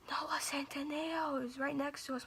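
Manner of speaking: hushed, voiceless whisper; secretive whisper. Retold or imitated. Cousin pulling her aside with news, secretive whisper.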